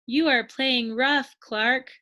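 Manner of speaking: ordinary delivery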